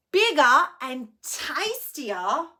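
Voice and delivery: loud, rising greedy troll voice. Acted. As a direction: savour the words as a greedy troll. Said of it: "said the greedy troll".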